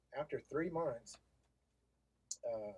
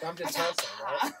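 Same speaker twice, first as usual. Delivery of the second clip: laughing; 5 semitones higher, 9 dB louder than usual. Real, not acted. Laughing after pun.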